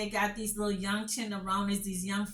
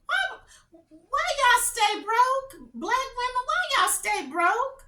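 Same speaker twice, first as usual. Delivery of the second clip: much higher, slow, drawn-out challenge; exasperated mock question; 13 semitones higher, 6 dB louder, slower than usual. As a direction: throw the question back in mock outrage. Mocking headline to viewers.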